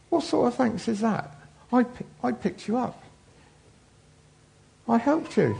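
put-on a wronged girl protesting; indignant little girl. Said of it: "She said".

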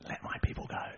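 hushed, voiceless whisper. Retold or imitated. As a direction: whisper it as a solemn echo. God's words repeated, hushed.

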